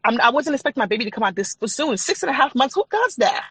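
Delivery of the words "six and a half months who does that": high-pitched, rising disbelief; incredulous. Real, not acted. Mother telling of her premature birth, incredulous. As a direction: exclaim it as an absurd question.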